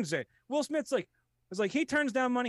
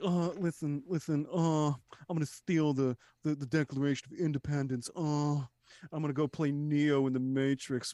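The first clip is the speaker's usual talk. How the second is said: Nicolas Cage impression; 5 semitones lower than usual. Mocking Cage's film choices in a lower halting voice. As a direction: stumble through it like a spaced-out actor.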